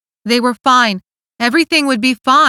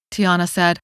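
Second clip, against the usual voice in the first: usual speaking voice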